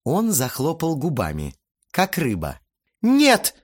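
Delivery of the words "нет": loud, very high protest; indignant squeal. A narrator's reading. Aunt bursts out at the boy's insolence, squealing indignantly. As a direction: squeal it in outrage. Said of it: "взвизгнула тётя Марина".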